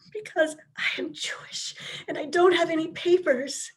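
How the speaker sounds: breathless, terrified whisper with no voice; frightened whisper. Acted. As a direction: whisper it so the police can't hear. Frightened woman hiding from police.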